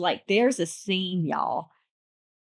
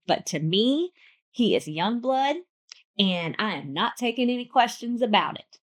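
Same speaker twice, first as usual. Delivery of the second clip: ordinary delivery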